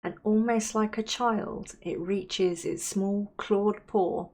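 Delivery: unhurried, soothing visualisation, softening at phrase ends; guided meditation. Acted. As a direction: guide them gently into calm, leaving space to breathe.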